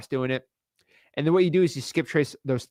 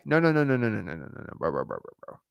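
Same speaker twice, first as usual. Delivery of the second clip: fading no no no; 5 semitones lower, 7 dB quieter than usual. Real, not acted. Rejecting the objection, fading.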